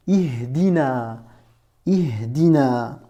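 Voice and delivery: Ihdina, Ihdina drawn out slowly syllable by syllable; slow syllable demo. Shown as a demonstration. Teacher models Ihdina slowly for a beginner, drawn out. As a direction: spell out Ihdina slowly for the learner. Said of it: "tu dis".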